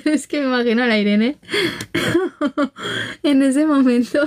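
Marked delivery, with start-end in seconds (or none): 1.4-3.2 soft high giggle fading down; breathy high giggle; laughter. Real, not acted. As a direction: giggle quietly at the thought. Laughing at imagined scene.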